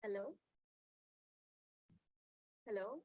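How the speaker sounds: very faint, far from the mic; barely audible. Real, not acted. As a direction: voice too low to hear clearly. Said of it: "your voice is very low".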